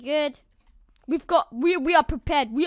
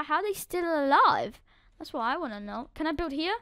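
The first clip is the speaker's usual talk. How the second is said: normal talking voice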